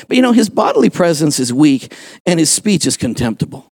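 ordinary talk

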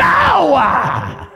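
high, loud commanding cry; forceful shout. Acted. Pastor driving out the green man, loud cry. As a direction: blast the sound out. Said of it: "you have to say it like that".

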